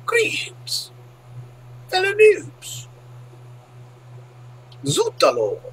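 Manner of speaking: high, exclaimed mock French; bad French accent. Game caster greeting French viewers with exclamations, in a bad French accent. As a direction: cry out in a cartoon French accent. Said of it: "I have no French accent".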